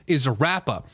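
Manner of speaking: normal talking voice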